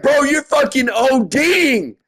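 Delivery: high, slow shouted warning; desperate shout. Retold or imitated. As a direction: yell the truth at him, slowly. Shouting at overdosing friend.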